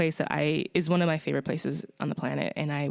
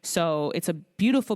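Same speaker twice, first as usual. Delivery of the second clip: ordinary talk, nothing marked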